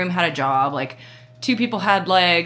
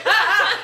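high startled "Ah!" with a laugh; high yelp, laughing; mock startled yelp; 13 semitones higher, 3 dB louder than usual. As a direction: imitate the fiance caught off guard. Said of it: "and he was like".

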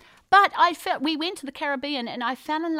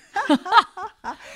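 loud giggling laugh; burst of laughter; 7 semitones higher, 6 dB louder than usual. Real, not acted. Guest laughing at her own burp joke, loud giggle. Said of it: "That would be Mum's no-no".

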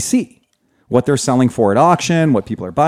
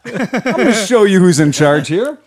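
usual speaking voice

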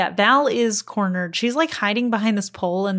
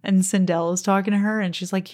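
ordinary talk, nothing marked

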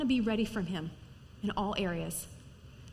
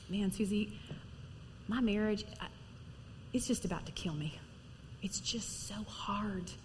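weary confiding; 4 semitones lower, 7 dB quieter than usual. Retold or imitated. Struggling wife confides in Susie, weary and hushed. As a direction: imitate someone quietly at the end of their rope. Voices a struggling listener's complaint.

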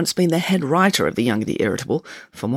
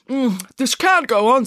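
high, slow, savouring protest; half-hearted complaint after a tasty mouthful; objecting while enjoying cake; 8 semitones higher, 4 dB louder, slower than usual. Acted. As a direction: hum with pleasure, then object. Director objects while eating cake.

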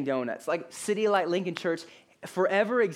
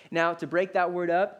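ordinary delivery

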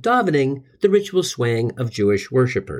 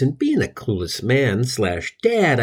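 usual speaking voice

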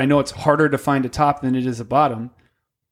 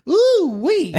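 higher-pitched mock Southern senator, squealing at the end; raised caricature drawl rising into a high whoop; Lindsey Graham. Senator spotting a handsome man, mock Southern drawl. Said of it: "he talks like this".

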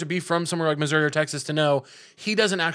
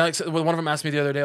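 usual speaking voice

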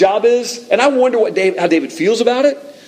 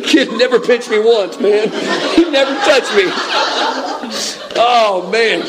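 laughing; 6 semitones higher than usual. Real, not acted. Laughs at his own mistake.

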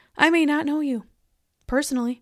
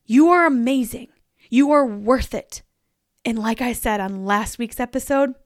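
ordinary delivery

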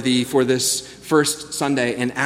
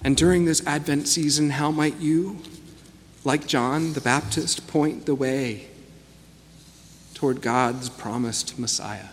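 guided meditation; 2 semitones lower, 7 dB quieter than usual. Acted. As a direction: guide them gently into stillness. Leads a guided reflection.

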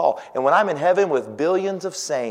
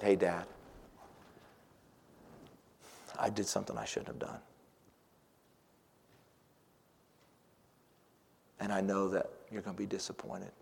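much softer, lower, whispered; whispered confession; 5 semitones lower, 15 dB quieter than usual. Retold or imitated. Child confessing to dad in a whisper. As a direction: hush it like a guilty child.

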